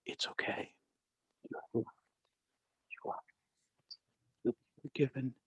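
hushed, soothing whisper to a dying man; whispering comfort. Retold or imitated. Pastor whispering to a dying man in his arms, hushed and soothing. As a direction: breathe it softly into his ear. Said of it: "I'm whispering in his ear".

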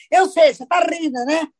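ordinary talk

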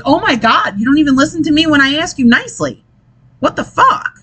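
retold outburst, pitch leaping at the end; exasperated retort. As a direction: act out a fed-up mom losing patience. Retold argument with her son.

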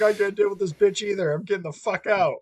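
high, exasperated demon voice; mock fed-up demon. Demon bailing out of the possessed woman, mock exasperated. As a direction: play a demon desperate to leave.

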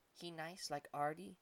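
slow, soft little creature asking; a small monkey holding back tears; tearful monkey voice. A narrator's reading. Monkey asks with a tear.

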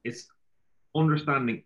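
ordinary talk

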